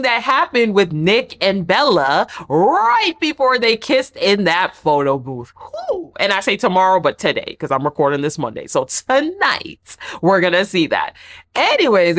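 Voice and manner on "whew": dramatic whew. Real, not acted. Reacting to drama preview.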